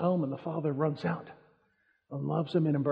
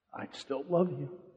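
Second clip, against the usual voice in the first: tender; 3 semitones lower, 8 dB quieter, slower than usual. Retold or imitated. Father tender reply.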